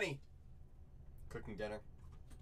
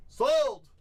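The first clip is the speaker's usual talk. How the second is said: mock auction shout; 6 semitones higher, 8 dB louder than usual. Chat buyer claims team.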